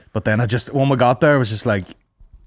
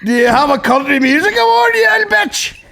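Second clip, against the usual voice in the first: mock taunt; 13 semitones higher, 4 dB louder than usual.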